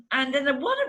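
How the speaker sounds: ordinary talk, nothing marked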